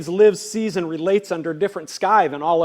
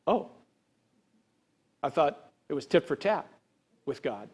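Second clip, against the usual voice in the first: quiet, lower, sheepish realization; deflated listener; 4 semitones lower, 6 dB quieter than usual. Acted. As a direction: play someone gently taken aback. Voices a deflated, corrected listener.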